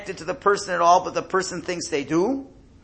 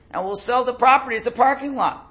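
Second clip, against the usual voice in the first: excited proposal; 8 semitones higher, 5 dB louder than usual. Retold or imitated. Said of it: "So one of them says".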